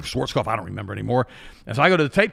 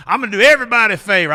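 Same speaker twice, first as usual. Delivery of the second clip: fast, higher, louder mock self-importance; smug lady's thought; 7 semitones higher, 8 dB louder, faster than usual. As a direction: voice her smug plan quickly. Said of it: "This lady comes up thinking".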